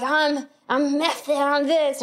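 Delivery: hammed-up actorly insistence; crazy actor. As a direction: draw it out as an over-committed auditioner. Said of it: "that crazy actor that's like".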